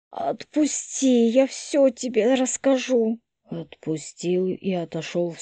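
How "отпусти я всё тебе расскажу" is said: higher, breathless raspy whisper. Acted. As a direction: plead in a choked whisper. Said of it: "Тихий ответ".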